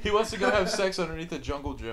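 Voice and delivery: loud, high teasing; excited teasing. Real, not acted. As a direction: tease him loudly. Teasing friend.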